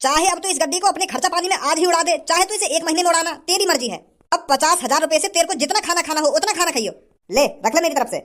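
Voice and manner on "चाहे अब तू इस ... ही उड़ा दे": normal talking voice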